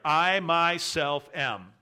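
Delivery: "I myself am" slow and loud; emphatic pronoun. Shown as a demonstration. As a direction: stretch out "I myself am" with heavy weight. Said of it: "there's an emphatic pronoun here".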